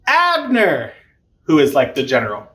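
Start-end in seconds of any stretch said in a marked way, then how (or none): 0.1-0.9 high, drawn-out name call; mock yell. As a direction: imitate hollering down a hill, without full volume. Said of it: "he yells down".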